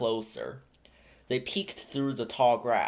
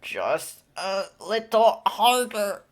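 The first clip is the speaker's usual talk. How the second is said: higher, louder, slow, straining with effort; straining grunt; 9 semitones higher, 5 dB louder, slower than usual. A narrator's reading. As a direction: push the words out while pulling hard. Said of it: "Simba grunted".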